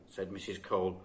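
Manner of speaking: ordinary delivery, nothing marked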